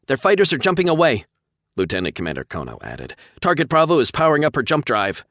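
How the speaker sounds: high loud report; urgent report. A narrator's reading. Officer reporting to captain, urgent.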